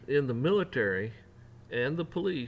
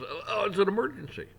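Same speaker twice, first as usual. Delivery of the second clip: mock panic; 9 semitones higher than usual. Mocking officials' excuse.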